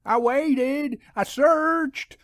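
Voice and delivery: high, anxious, pleading insistence; a jittery young man swearing he tried; nervous insisting. A narrator's reading. Deacon nervously insists to the narrator, high and pleading. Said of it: "the nervous deacon insisted".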